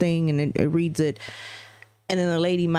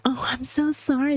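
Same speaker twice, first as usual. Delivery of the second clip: higher, put-on voice of the lady; mock apology; 7 semitones higher than usual. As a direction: mimic her fake sorry. Mocking the lady's apology.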